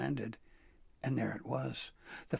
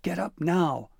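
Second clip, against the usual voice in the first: loud, high crow command; furious demand; 5 semitones higher, 7 dB louder than usual. Acted. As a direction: order him up, furious. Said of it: "the furious bird demanded".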